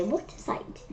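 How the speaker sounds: breathy whispered awe; awed whisper. Acted. Staring people in the story, hushed whisper. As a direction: whisper it in wonder.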